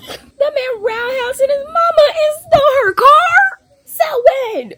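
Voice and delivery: very high, loud incredulous shriek; real high-pitched shout of shock; shocked outcry. Real, not acted. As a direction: yell it in disbelief. Shocked reaction to the book.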